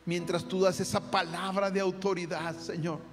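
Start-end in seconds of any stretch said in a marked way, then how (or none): none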